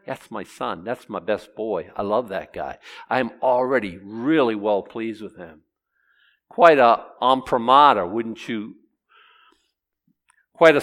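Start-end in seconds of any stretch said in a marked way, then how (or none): 0.1-5.6 low, soft, fond declaration; tender fatherly pride. Acted. God testifying about his beloved son, tender quiet pride. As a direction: drop low and speak tenderly of your boy.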